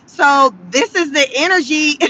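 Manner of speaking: ordinary delivery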